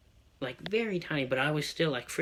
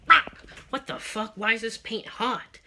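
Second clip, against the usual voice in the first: higher-pitched retold cry of disbelief; pained outburst; 8 semitones higher, 5 dB louder than usual. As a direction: replay a startled yelp at the burn, pitch up. Reacting to burning paint in retelling.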